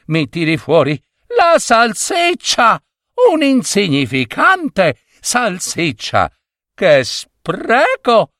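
high-pitched wife hurling insults; furious scolding. A narrator's reading. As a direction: rant in outrage, building to exasperated cries. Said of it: "con ingiurie e urla". (sfuriata acuta piena di ingiurie)

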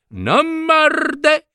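high, sharp cry of a fussy lady; exclaiming woman. A narrator's reading. Woman insisting to the narrators that her dog doesn't bite, high exclamation. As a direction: pitch way up as the worried dog owner. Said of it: "esclamò la donna".

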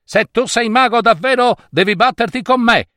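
high-pitched challenge; defiant young hero. A narrator's reading. Young man challenges the wizard to fight, defiant. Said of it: "disse il giovane".

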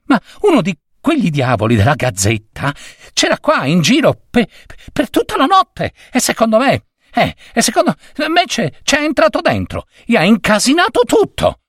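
put-on high, fast, indignant boy's voice; agitated boy. Boy accusing the Gazzetta men to his boss, high and hurried. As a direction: rush to blame the rival paper.

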